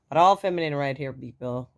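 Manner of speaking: loud, fast playful claim; playful boast. Real, not acted. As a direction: tease the listeners. Joking about her deep voice.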